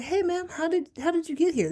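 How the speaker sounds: high, bright host voice; talk show host. Retold or imitated. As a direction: play the chirpy TV host. Host interviewing dancing mothers.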